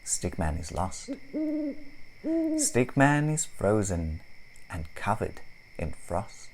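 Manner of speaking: ordinary delivery, nothing marked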